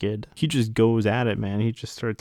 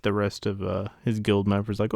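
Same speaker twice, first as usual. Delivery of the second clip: ordinary talk, nothing marked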